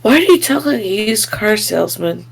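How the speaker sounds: ordinary talk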